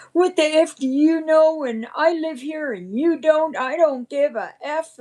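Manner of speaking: imitating an aggressive neighbor. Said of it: "and he said".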